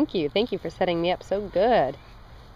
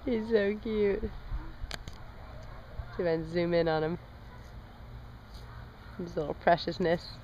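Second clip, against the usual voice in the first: soft aside; 4 semitones lower, 11 dB quieter than usual. Real, not acted. Aside about cute toddler.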